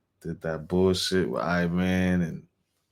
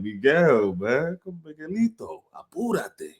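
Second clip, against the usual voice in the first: bodega owner on phone; 8 semitones higher, slower than usual. Retold or imitated. Store owner calls his son on the phone, mock Spanish. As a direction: call to Miguelito to hurry, lightly hammed.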